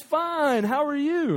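high, sing-out return greeting; friendly reply. Retold or imitated. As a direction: imitate a cheery neighbor answering from afar. Dad calls the greeting back.

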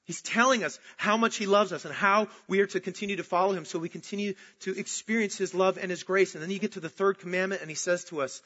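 usual speaking voice

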